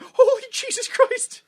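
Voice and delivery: very high, loud retold shock; shocked exclamation. Viewer reacting to manager's hanging line, mock shock. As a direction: burst out in exaggerated disbelief, half laughing.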